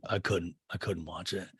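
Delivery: low, soft, imitated confession; sheepish admission. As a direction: admit it quietly, voice dropped. Acts Shep's sheepish admission.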